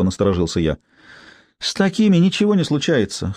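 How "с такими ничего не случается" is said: high, scoffing dismissal; scornful snort. Acted. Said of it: "хмыкнула Цыпка".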